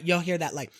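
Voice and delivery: ordinary talk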